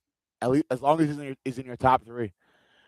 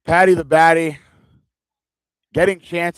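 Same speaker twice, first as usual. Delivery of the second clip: excited; 4 semitones higher, 9 dB louder than usual. Real, not acted. Excited reaction to fighter live.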